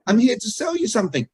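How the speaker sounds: high-pitched echo of the hidden message; what is really heard. Shown as a demonstration. Coach echoing what customers hear from salespeople, high and emphatic. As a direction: raise the whole line, falling at the end. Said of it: "What do we actually hear them saying".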